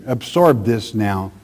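usual speaking voice

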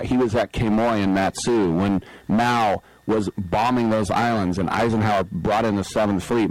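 ordinary delivery

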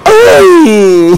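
hammed-up pained shriek; imitated yelp of pain. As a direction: yelp like someone who just hit his finger. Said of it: "Y que gritó".

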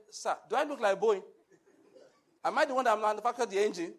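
loud, laughing mock protest. Dead crash passenger answering Jesus, loud laughing protest. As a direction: laugh through an indignant excuse.

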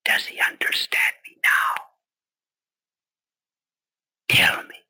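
hushed, whispered question in prayer; speaking low. Acted. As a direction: drop to a confiding whisper. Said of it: "if I speak low".

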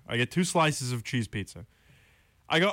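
normal talking voice

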